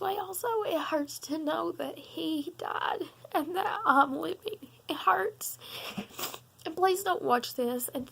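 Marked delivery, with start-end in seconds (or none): 0.7-4.7 real tears breaking through, laughing and crying at once; sobbing. Real, not acted. Grieving granddaughter speaking to viewers through real sobs. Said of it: "I'm sorry that I'm sitting here sobbing". 4.9-5.6 broken whisper. Real, not acted. Grieving, breaks to a whisper.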